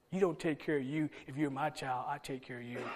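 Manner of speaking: soft, hushed voice of Jesus reassuring; tender reassurance. Retold or imitated. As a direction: speak it gently like a caring father.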